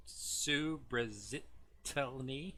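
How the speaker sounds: very slow "Subrazitelny", syllable by syllable, rising into a question; struggling pronunciation. Real, not acted. Host defeated by a ship's name, struggling pronunciation. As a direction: drag out "Subrazitelny" unsurely, then give up laughing. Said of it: "Not even gonna try to pronounce it".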